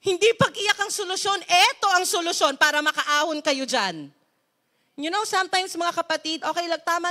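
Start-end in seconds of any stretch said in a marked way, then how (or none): 0.1-4.1 louder, commanding voice of God; a stern God calling Joshua to rise; God's firm command. Acted. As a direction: order him to get up with authority. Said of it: "Sabi ni Lord".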